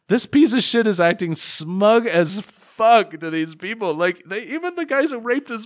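exasperated, leaning on each word; indignant rant. Real, not acted. Indignant rant about the character.

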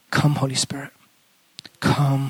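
ordinary talk, nothing marked

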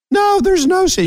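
imitating a guest's nervous high-pitched denial; voice going up. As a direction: pop way up in pitch while insisting there's no stress. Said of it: "your voice went up an octave".

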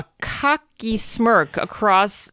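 half speed, word by word; deliberate slow rereading. Shown as a demonstration. Reader repeating the sentence that disturbed them, slowly and deliberately.

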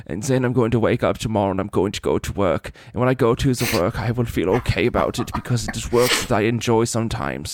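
put-on deliberate German-accented monologue; Werner Herzog. Host voices his internal monologue, mock German accent. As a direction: narrate daily life in a measured German accent. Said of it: "channeling Werner Herzog".

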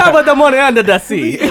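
hammed-up psychic hotline voice; mock Jamaican accent. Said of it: "She was Miss Cleo and shit".

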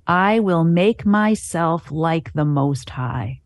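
each word stretched out slowly to show arrogance; slow, pointed reading. Shown as a demonstration. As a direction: read it very slowly, letting the pride show. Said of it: "Listen to this lack of humility".

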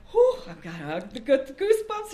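high-pitched, excited whew; mock goosebumps thrill. Imitating colleague who gets goosebumps when the dead come, excited. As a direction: imitate someone thrilled by shivers.

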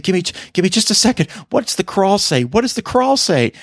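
very high, fast flustered plea; flustered stalling. Retold or imitated. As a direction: rush it out high, squinting past her. Begging to read the crawl.

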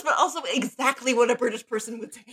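fast, high, laughing through it; can't stop laughing at the joke. Real, not acted. Cohost recalling favorite line, laughing hard. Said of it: "that makes me laugh so hard".

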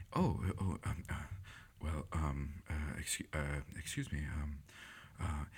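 quiet, flustered. Retold or imitated. Dazed professor answering the moderator, quiet and hesitant. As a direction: stammer softly as if just woken from a trance. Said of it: "his speaking voice is actually kinda quiet".

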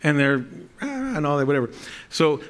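normal talking voice

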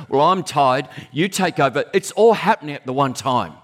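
ordinary talk, nothing marked

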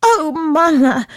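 high, drawn-out excited cry; slow breathless eager call; blurting out. Acted. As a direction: burst out with the news. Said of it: "blurting out".

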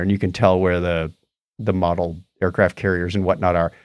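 ordinary delivery

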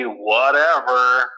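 ordinary talk, nothing marked

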